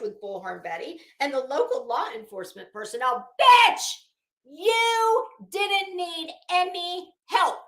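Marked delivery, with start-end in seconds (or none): 3.4-7.6 a much louder, higher "Bitch" that cracks out, then slow and cutting; explode, then drag out each word with contempt; scornful outburst. Real, not acted. Host blasting the woman for seeking police conflict, scornful yell. Ranting at the woman she covers.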